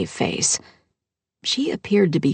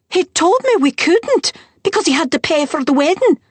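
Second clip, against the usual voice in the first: higher, louder complaining stepmother; aggrieved stepmother; 12 semitones higher, 5 dB louder than usual. Acted. Stepmother blurting out her husband's excuse, high and louder. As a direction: let the grievance rise high and loud.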